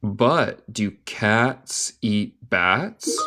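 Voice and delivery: slow, joined-up question, rising at the end; slow connected question. Shown as a demonstration. Teacher repeating the question for learners, slow and connected. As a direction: ask it slowly in one go.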